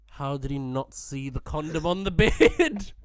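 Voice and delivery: builds to a loud, high last word; incredulous outburst. Retold or imitated. Storyteller baffled the father missed it, incredulous outburst. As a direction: burst out in disbelief on the final word.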